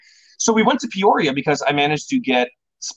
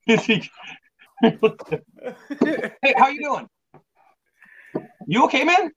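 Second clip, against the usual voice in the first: laughing; 10 semitones higher, much slower than usual. Real, not acted. Teasing guest who left laughing.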